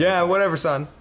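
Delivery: mock indifferent dad; bored dad voice. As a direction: brush the kid off like a careless dad.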